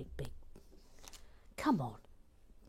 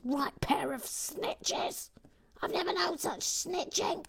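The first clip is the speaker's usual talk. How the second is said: much higher, slow caricature voice; high mock outrage; 12 semitones higher, slower than usual. Host scolding two snitching viewers, high-pitched mock outrage. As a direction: squeal the disapproval, drawn out.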